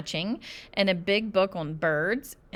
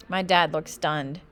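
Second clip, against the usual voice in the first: plain reading voice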